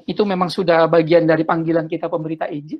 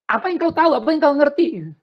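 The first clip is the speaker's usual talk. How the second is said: much higher mock scolding of a child; scornful Batak elder; 8 semitones higher than usual. An elder brushing off a young evangelist, scornfully. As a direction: sneer down at the kid. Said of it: "orang Batak memang sering ngomong begitu".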